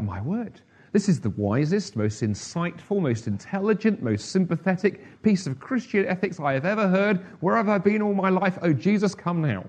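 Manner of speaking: mock gushing praise. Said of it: "No, you're laughing".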